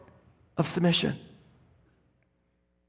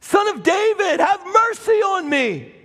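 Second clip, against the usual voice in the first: desperate shout; 9 semitones higher than usual. Acted. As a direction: beg at the top of your lungs. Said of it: "he began to shout".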